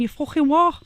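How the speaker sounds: loud, high Scouse "You fucking what?"; Scouse swearing. Shown as a demonstration. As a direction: spit it out like an angry Liverpudlian. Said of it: "the only time I can do Scouse if I'm swearing".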